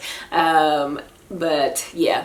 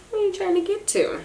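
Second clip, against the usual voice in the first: much higher, quick coo; 12 semitones higher, 4 dB quieter, faster than usual. Real, not acted. Mother asks baby in high baby talk.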